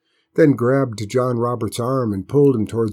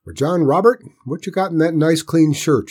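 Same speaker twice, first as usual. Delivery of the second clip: high, loud country-aunt demand; scolding aunt's raised voice; 7 semitones higher, 6 dB louder than usual. A narrator's reading. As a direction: interrogate the boy sternly. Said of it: "she demanded".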